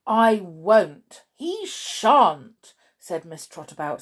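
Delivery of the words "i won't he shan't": loud, emphatic defiant refusal; fierce. A narrator's reading. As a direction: refuse outright, fiercely protective. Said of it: "suddenly looking so very fierce".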